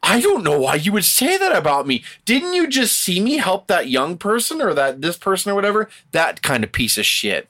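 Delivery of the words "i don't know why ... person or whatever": higher, wounded mock innocence; playing innocent. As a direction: play the phony caught out, acting hurt. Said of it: "they're like".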